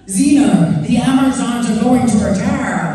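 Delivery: hammed-up American TV warrior queen, slow; bad American accent. Actress mocking her old Xena role in a bad American accent. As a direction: overact it in a terrible American accent. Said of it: "the worst American accent that's ever been seen".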